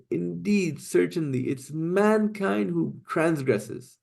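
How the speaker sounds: drawn out slowly, each word weighted; emphatic reading. Shown as a demonstration. Teacher summing up the verse's layered emphasis, slow and high. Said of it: "so many emphases present here".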